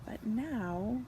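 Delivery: soft unvoiced whisper; whispered sleepy. A narrator's reading. Sleepy bulldozer.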